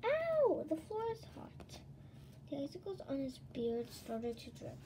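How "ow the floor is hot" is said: high, yelping pained cry; Jack Frost crying out. Acted. Jack Frost leaping off the burning floor: high yelp. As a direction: yelp as the hot floor burns. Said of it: "With a cry".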